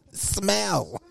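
one high-pitched, falling word; imitated movie delivery. Showing friend the way he said it, high imitation. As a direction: squeal the word as he did. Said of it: "The way he said it".

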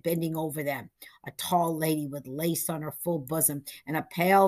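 usual speaking voice

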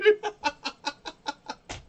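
loud, high laughing fit; loud high laughter. Real, not acted. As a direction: laugh hard, pitch up. Laughing at own mix-up.

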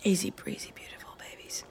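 breathy whisper. Real, not acted. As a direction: whisper it to the team. Excited aside to teammates.

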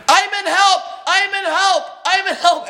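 high, loud repeated call-out; imitated yelling. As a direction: yell it like calling across the court. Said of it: "Alex just yells".

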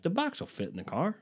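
high-pitched, rising hopeful quote; optimistic. Retold or imitated. Sizing up a grill box at the store, optimistic. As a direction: say it high, lifting at the end. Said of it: "I'm being really optimistic".

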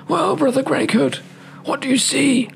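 high-pitched eager dog; hound voice. Acted. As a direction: squeak it out as the impatient hound. Hound asks the donkey.

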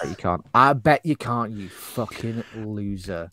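needling, trailing into a quiet insult; taunting dare. Acted. Bartender dares married Cruise to take the bait, taunting. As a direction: goad him, ending slow and soft.

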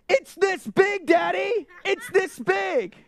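put-on high, loud little-kid voice; excited child. Daughter telling daddy about the huge spider, high and excited. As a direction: shout it like a wide-eyed child.

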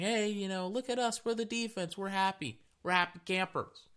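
high, giddy bragging players; high happy-camper mock voice; gleeful defense voice. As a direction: voice the smiling defense, high and pleased with itself. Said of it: "is saying".

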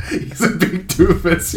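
says it through a laugh; giggling over the word doofus. Real, not acted. Laughing with her co-host about the actor.

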